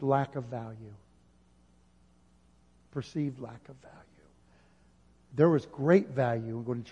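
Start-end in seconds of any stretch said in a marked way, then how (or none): 2.9-4.0 much softer, letting it sink in; soft echo. Acted. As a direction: repeat it almost under your breath. Repeating the key answer softly.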